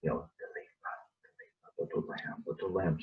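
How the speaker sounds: breathy near-whisper, barely voiced; whispered tenderly to a lamb; very soft. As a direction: hush it as if soothing a frightened child. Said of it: "he says it very softly".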